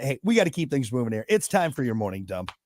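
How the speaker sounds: usual speaking voice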